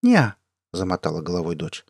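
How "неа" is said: put-on high, louder little-girl denial; quick high-voiced childish refusal; child's "nope". A narrator's reading. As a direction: pipe up like a small daughter. Daughter's reply voiced in story.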